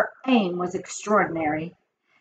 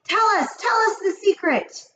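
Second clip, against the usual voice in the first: very high, eager pleading; high-pitched, excited urging; impatient clamour; 12 semitones higher than usual. A narrator's reading. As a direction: beg like an impatient witch audience. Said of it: "cried the audience impatiently".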